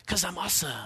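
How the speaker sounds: mock-smug kid answer; lightly voiced bragging kid; cheeky kid. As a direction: toss it off like a cocky child. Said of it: "they'll go".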